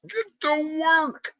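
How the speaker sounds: squeaky cartoon voice, very high and slowly stretched; hammed-up high mock wake-up yell, dragging every word; Mike's alarm voice. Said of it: "I'm gonna get his voice".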